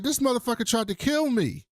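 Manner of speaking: high-pitched mock outrage; acted-out indignant tattling, high voice; indignant complaint. Retold outraged call to his daddy.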